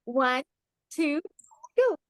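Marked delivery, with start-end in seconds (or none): none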